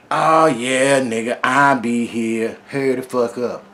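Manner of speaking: loud, slow sneering dare; taunting thug. Retold or imitated. Robber taunting the storyteller, loud slow sneer. Gang member taunting him back.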